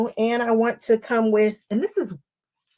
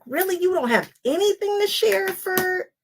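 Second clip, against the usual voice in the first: high, teasing scold of herself; a hint of a playful chiding friend; mock scolding; 9 semitones higher than usual. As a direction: tease yourself like a disappointed friend. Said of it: "I was like".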